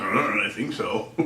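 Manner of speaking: low, soft, slow hesitant reply; unsure lion. Pretend. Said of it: "the other lions are just like".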